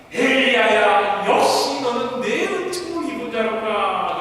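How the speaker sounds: loud, slow call then hearty praise; a proud master blessing his prophet; praising Jesus. Acted. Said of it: "그러자 또 우리 예수님께서".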